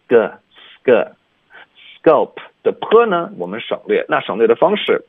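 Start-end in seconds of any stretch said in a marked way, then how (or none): 0.1-1.1 "sk" said as "sg", k softened to g; voiced k. Shown as a demonstration. Teacher showing students, "sk" with k voiced as g. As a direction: turn the k in "sk" into a g. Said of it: "浊化成个".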